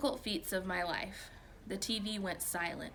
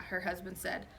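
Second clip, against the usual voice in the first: plain reading voice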